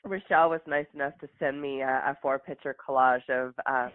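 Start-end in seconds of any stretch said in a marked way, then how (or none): none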